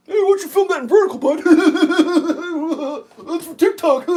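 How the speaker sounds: high laughing mock of a heckler. Mocking someone who tells him to film vertical, laughing high. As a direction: parody the heckler while laughing.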